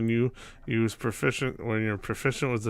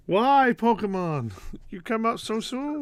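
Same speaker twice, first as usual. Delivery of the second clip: mock despair; 10 semitones higher, 3 dB louder than usual. Mock lament to Pokemon.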